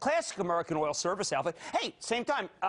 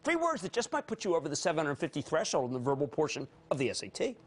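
normal talking voice

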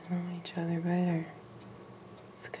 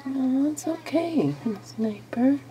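high soothing; 8 semitones higher, 5 dB louder, slower than usual. Real, not acted. Calming the lizard.

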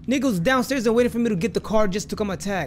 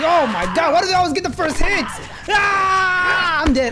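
panicking gamer; 5 semitones higher than usual. Real, not acted. Getting hit in game.

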